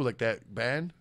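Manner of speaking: ordinary delivery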